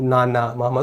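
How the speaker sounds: ना ना मामा said softly with the nose pinched closed; soft blocked-nose ना ना मामा for a giggling baby daughter; nose held shut. Shown as a demonstration. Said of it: "वो मेरी नाक को बंद करती है".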